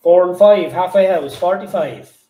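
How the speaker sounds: loud sing-song bingo calls; bingo caller. Acted. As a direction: call the numbers like a bingo hall. Bingo number calling.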